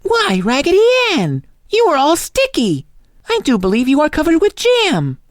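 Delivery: very high exclaiming voice; surprised mistress. A narrator's reading. Mistress discovers jam on Raggedy Ann, high surprise. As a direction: exclaim in high-pitched astonishment.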